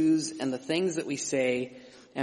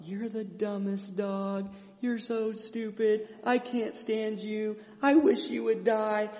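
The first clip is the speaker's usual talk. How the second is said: higher, much softer, slow insult said sweetly; insulting the dogs; 7 semitones higher, 4 dB quieter, slower than usual. Acted. Preacher saying cruel words to his dogs in a higher, softer, slow voice. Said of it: "I can do this as well".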